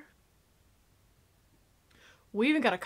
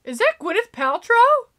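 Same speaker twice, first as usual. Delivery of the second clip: higher, louder excited question; excited surprise; 7 semitones higher, 5 dB louder than usual. Real, not acted. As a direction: ask it in delighted disbelief. Repeats the question more excitedly.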